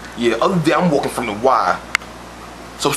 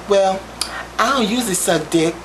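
higher mock woman's voice; 6 semitones higher than usual. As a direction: voice the woman, pitched up and prim. Woman's voice in retold exchange.

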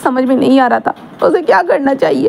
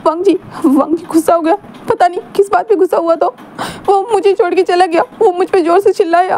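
higher, hurt and pleading; upset, near tears; 5 semitones higher than usual. Acted. Wing on the phone to his friend, higher and hurt. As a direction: tell it like a wounded kid about to cry.

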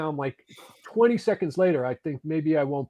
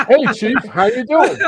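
greeting; 9 semitones higher, 8 dB louder, slower than usual. Retold or imitated. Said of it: "and I said".

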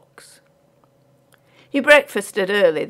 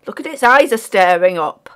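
loud rustic working man; marvelling porter; 2 semitones higher, 8 dB louder than usual. A narrator's reading. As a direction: call out the discovery.

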